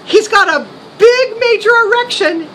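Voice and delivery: ordinary delivery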